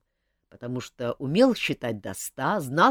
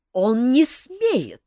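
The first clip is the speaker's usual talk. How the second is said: furious; 10 semitones higher, 9 dB louder, much slower than usual. A narrator's reading. Countess in despair smashes cup.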